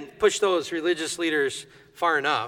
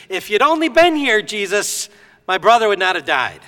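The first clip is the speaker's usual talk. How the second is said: a raised, reproachful plea; frustrated Martha; 4 semitones higher, 5 dB louder than usual. Retold or imitated. Martha reproaching Jesus for coming too late, frustrated. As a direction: let a grieving sister's frustration spill over. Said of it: "you can hear this frustration in Martha's voice".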